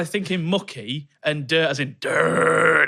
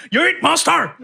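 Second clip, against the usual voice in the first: Ute Muster in a laughing, higher, hammed-up Irish lilt; mock Irish accent; 11 semitones higher, 5 dB louder, slower than usual. Teasing Matt's accent, [name] laughs out a mock Irish version. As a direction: Ute Muster done as a joking Irish brogue. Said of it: "I thought it was Irish".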